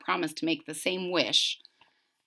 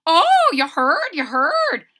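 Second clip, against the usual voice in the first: bird's skeptical reply; 7 semitones higher, 7 dB louder, slower than usual. Acted. Bird character sarcastic.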